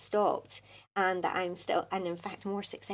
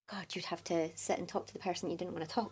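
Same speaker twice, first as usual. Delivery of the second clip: exasperated whisper; 10 dB quieter than usual. Retold or imitated. Dreaded obligation, aside.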